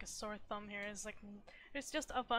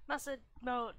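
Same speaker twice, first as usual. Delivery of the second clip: usual speaking voice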